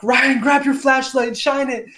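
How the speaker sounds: high retold shout; high, urgent imitated calling. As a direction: call out an order to someone off from you. Retold companion calling urgently down mountain.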